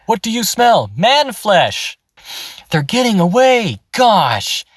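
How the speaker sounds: imitating a dubbed orc who sounds like a regular dude; normal-guy orc voice. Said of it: "he does normal, normal voices over 'em".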